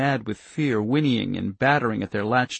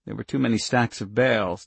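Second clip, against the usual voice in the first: normal talking voice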